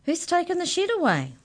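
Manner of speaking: high-pitched startled question; shocked outburst, pitched right up; alarmed disbelief. A narrator's reading. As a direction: pipe up in astonishment. Boy alarmed that shed has vanished.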